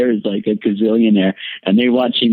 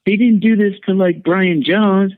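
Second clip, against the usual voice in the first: much higher, mock outraged pleading; retold frantic protest; 10 semitones higher than usual. Protesting to the men who handcuff him, high mock outrage. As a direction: protest wildly but with a wink. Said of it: "And I'm like".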